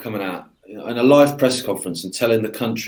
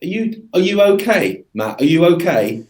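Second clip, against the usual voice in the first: mock concern; 5 semitones higher, 3 dB louder than usual. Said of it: "I remember seeing that going".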